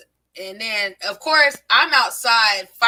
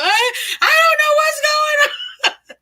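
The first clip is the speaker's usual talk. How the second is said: much higher, slow, wailing sobbed cry; hysterical crying; 3 dB louder, slower than usual. Retold or imitated. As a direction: sob it out like a scared kid caught fighting. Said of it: "I just start crying hysterically".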